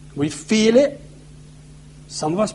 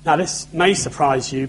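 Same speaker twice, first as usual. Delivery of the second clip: normal talking voice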